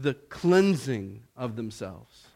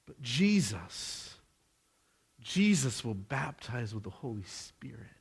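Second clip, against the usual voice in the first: ordinary talk, nothing marked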